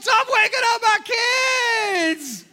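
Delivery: high, loud yell at the dog; furious shout. Retold or imitated. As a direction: scream over the barking. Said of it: "I'm like".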